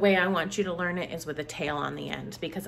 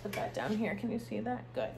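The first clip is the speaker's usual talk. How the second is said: quiet aside; 12 dB quieter, faster than usual. Real, not acted. Teacher talking softly while placing card.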